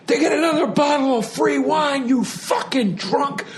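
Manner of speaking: high mock tirade, slowing on the insults; drunk screaming woman. Drunk wife laying into a complaining diner, high mock scream. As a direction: imitate a drunk Jersey wife ranting. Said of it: "She's shit-faced and she's like".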